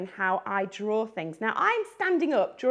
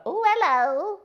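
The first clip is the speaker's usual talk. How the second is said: flirty granny; 7 semitones higher, 6 dB louder than usual. Acted. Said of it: "going on a date with Santa".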